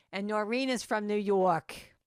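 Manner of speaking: affectionate mock New York accent; Noreen. Speaker fondly imitating her friend from New York, mock New York accent. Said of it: "I mock her like this because I just love her accent".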